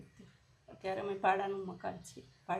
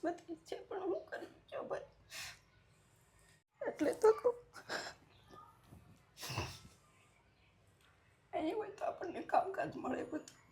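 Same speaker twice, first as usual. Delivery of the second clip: high voice cracking with real crying; breaking down tearfully; 8 semitones higher than usual. Real, not acted. Widow telling interviewer of her responsibilities, breaking down in tears. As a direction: struggle to speak as tears take over.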